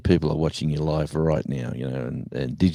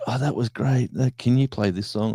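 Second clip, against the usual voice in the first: higher mock eager caller; a hint of a keen listener; 6 semitones higher than usual. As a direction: chirp like a fan on the phone. Talkback caller voiced.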